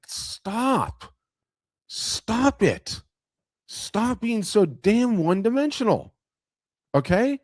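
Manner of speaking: high-pitched, drawn-out exasperation; exasperated plea. Acted. Coach reacting to artists' excuses, exasperated. Acts exasperated reply to complainers.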